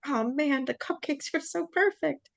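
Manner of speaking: very high, mock-distressed lament; retold whine. Speaker to partner over spoiled cupcakes, high-pitched whine.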